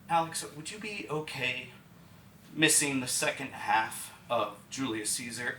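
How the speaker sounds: soft, careful, halting question; gentle serious request. Retold or imitated. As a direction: ask it quietly, with pauses. Doctor C asks him gravely.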